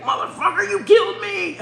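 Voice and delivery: high, loud mock raging yell; imitating gamer rage. Mom imitating son raging at video games, mock rage. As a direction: mimic a gamer's outraged yell, half laughing. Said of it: "he's raging at the game".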